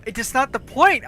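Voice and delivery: mock exasperated protest. Teller arguing with friend over countries, mock exasperated protest. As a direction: object with playful exasperation.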